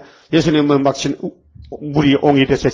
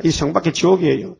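ordinary talk

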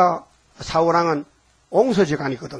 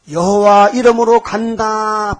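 bold declaration; 3 semitones higher, 6 dB louder, slower than usual. Acted. David declares before Goliath.

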